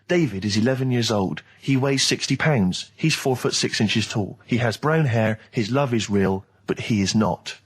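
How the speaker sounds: low, slow movie tagline read; measured, low-pitched trailer narration. Retold or imitated. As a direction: intone it gravely like a film trailer. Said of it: "the tagline was".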